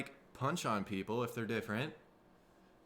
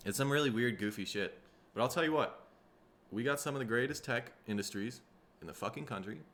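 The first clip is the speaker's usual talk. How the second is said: ordinary delivery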